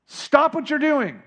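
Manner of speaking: high, slow, firm command; rebuke. Acted. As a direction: scold the waves to a halt. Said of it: "It was a rebuke".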